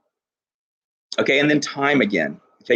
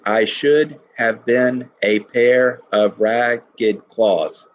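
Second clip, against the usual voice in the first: very slow, leaning on "should"; march through the line beat by beat; iambic scansion; much slower than usual. Shown as a demonstration. Teacher tests the iambic reading aloud, very slow stressed beats. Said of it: "So I think it's iambic".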